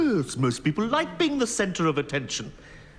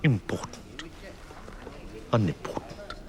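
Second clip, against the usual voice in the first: low, soft musing, word by word; low mutter with pauses between words; muttering to himself; 6 semitones lower, 8 dB quieter than usual. Acted. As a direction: try out each word under your breath. Judge mulls words to himself.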